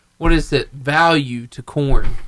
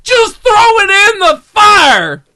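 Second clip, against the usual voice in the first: high, loud, slow frustrated yell; yelling at the screen; 8 dB louder, slower than usual. Retold or imitated. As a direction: shout at the movie screen. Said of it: "everybody in the theater's like".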